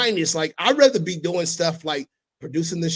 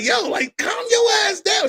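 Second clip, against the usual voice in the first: retold yell; 6 dB louder than usual. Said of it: "I was like".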